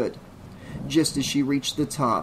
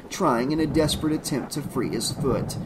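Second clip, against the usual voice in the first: ordinary delivery, nothing marked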